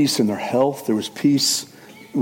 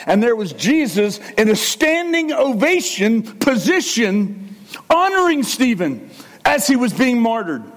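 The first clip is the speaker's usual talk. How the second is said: raised, fervent preaching voice; 8 semitones higher than usual. Acted. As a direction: preach it out with full conviction. Preaching the martyr stories.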